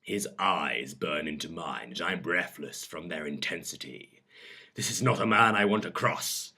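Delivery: dramatic audiobook reading; measured, expressive storyteller; story narration. A narrator's reading. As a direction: read an intimate romance scene aloud. Reads a story aloud.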